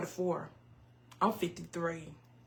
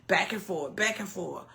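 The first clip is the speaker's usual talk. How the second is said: ordinary talk, nothing marked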